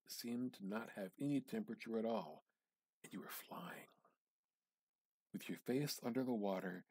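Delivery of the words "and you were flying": whispered. A narrator's reading. Narrator hushes at wonder.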